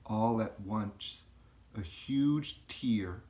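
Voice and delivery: usual speaking voice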